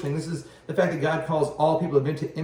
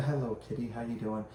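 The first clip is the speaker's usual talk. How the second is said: talking to the cat; 5 semitones lower, 10 dB quieter than usual. Real, not acted. Greeting the cat mid-recording.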